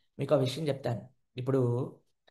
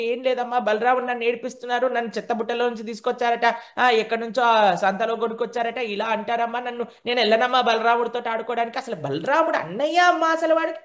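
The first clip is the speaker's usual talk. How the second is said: mock crying; 7 semitones higher, 4 dB louder than usual. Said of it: "ఏడ్చుకుంటూ కూర్చున్నాడటండి".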